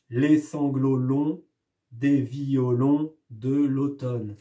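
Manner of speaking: slow, each syllable spaced to show the beat; rhythmic reading. Shown as a demonstration. Teacher showing the class the poem's rhythm, slow and spaced. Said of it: "s'amuse avec le rythme du poème".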